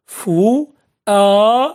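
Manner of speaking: فؤاد very slow, each syllable long and separate. Shown as a demonstration. Teacher sounding out an example for pupils, فؤاد syllable by syllable. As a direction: draw out فؤاد with pauses between syllables.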